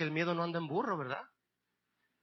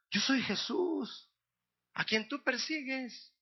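higher, very slow, solemn answer; Jesús; 6 semitones higher, 3 dB quieter, slower than usual. Acted. The Lord answers fallen Paul, slow and solemn. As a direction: stretch it out as a divine voice. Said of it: "Y le dijo".

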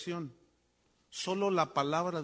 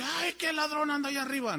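mock startled cry; 6 semitones higher than usual. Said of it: "No dice".

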